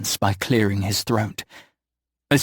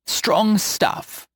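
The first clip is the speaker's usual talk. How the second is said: put-on old witch voice; 10 semitones higher than usual.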